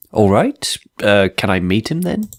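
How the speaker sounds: normal talking voice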